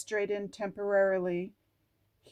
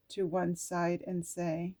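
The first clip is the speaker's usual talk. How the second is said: ordinary delivery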